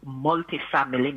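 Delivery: normal talking voice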